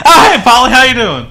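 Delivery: high, loud, laughing cover-up; fake innocent greeting. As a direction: greet him too brightly, laughing. Caught gossiping, faking innocence.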